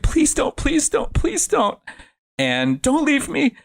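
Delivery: high, whimpering begging; imitated desperate pleading. Retold Adam begging not left.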